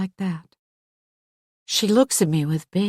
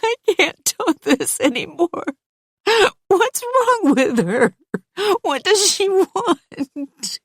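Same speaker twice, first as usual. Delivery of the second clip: high, slow tearful pleading; falling apart in tears; crying; 13 semitones higher, 3 dB louder, slower than usual. Acted. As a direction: Hannah breaking down on the bathtub edge. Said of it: "starts to cry".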